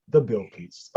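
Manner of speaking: lightly put-on English accent; retold request in plain English accent. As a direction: imitate yourself ordering in a very English accent. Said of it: "I say in the most English accent".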